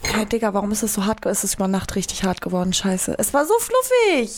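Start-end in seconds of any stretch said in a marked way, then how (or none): 3.2-4.4 whiny lament. Real, not acted. Beklagt hart gewordenen Teig.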